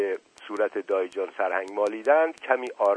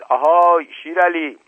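loud, raised-pitch hail; calling out; 8 semitones higher, 9 dB louder than usual. A narrator's reading. Asadollah Mirza summoning Shir Ali to scare Dust Ali, calling out. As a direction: call out for someone to come.

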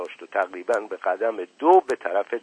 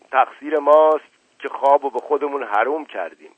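plain reading voice

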